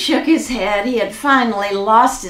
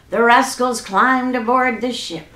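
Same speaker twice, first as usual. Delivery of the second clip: ordinary delivery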